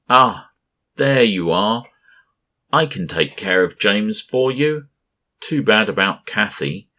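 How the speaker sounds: high, loud taunting monster voice; giant mocking voice. A narrator's reading. Gas giant Berenson mocks the captain, loud and taunting. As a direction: taunt like a huge cloud creature. Berenson creature gloats outside ship.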